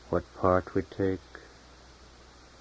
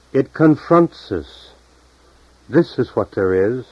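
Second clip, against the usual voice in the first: emphatic declaiming; 5 semitones higher, 9 dB louder than usual. Acted. Painter declaring what confronts us, loud emphatic declaiming. Painter's words voiced in poem.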